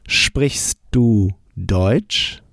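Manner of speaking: very slow, clear question "Sprichst du Deutsch?"; deliberately slowed German; slow model answer. Shown as a demonstration. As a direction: stretch it out for the learner to copy. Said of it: "How would you say".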